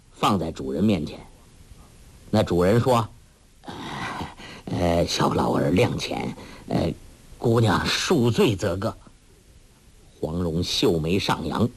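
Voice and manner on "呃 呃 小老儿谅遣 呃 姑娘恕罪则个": put-on lowered, hesitant old man begging; lightly voiced timid old host pleading; cringing old man. A narrator's reading. Frightened host begging Huang Rong to spare him, lower.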